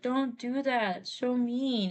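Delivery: slow hurt whine; whiny scolding. Retold or imitated. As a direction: whine at him to stop. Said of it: "Sanford's like".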